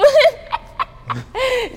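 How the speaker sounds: loud, high burst of laughter. Real, not acted. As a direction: crack up mid-story. Laughs at her own story.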